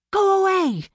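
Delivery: loud, high, sustained shout at the birds. A narrator's reading. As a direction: yell it out to scare birds off. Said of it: "she shouted".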